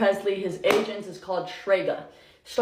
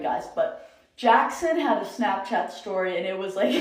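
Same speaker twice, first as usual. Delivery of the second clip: ordinary delivery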